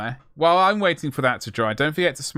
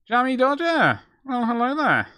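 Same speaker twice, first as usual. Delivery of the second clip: sudden high comic greeting, much higher; odd put-on voice; 11 semitones higher, 4 dB louder than usual. Painter greeting the mini, silly high put-on voice. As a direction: pop into a silly cartoonish voice. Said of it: "Don't know what happened to my voice there".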